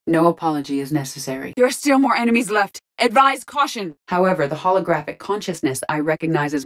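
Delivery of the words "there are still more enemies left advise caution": raised, pressing alert; combat warning. Acted. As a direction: caution the squad mid-battle. Warning squad of enemies.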